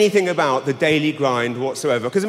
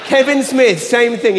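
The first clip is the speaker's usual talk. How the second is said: ordinary talk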